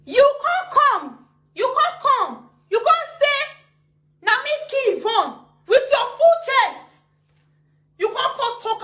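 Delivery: high, loud, slow and furious; heated outburst. Real, not acted. Ranting at the woman who accused her, high and angry. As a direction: hammer each word at the accuser.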